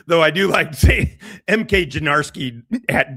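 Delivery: usual speaking voice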